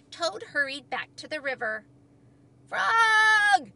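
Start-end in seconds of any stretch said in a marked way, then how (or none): 2.7-3.7 long, high, full shout; Toad's shout. A narrator's reading. Toad calls to Frog out on the island, shouting. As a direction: call across a river to a friend. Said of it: "he shouted".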